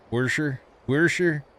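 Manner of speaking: "Wersher" said with an R in the first syllable, questioning; self-conscious accented pronunciation. Shown as a demonstration. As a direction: try out "Wersher" as a country way of saying washer. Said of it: "See, I can't say it again".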